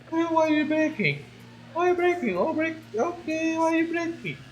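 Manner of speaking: high, soft, quick whine; high plea. Real, not acted. Player asking the game why it keeps breaking, high soft pleading. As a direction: fret at the car in a high small voice.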